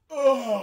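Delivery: high, loud exasperated groan; frustrated groan. Retold or imitated. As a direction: groan like it is painful to watch. Kids gearing up for battle again.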